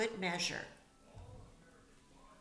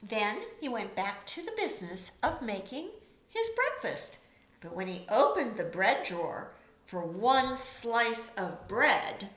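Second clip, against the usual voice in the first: ordinary delivery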